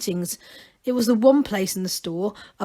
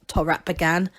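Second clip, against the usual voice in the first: plain reading voice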